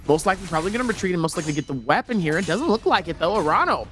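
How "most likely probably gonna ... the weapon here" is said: usual speaking voice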